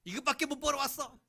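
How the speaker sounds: wife scolding husband for bringing home too little; nagging wife. Acted. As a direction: sneer at the small paycheck. Wife complaining about husband's pay.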